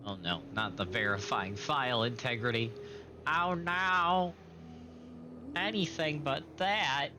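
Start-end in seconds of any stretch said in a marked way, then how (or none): none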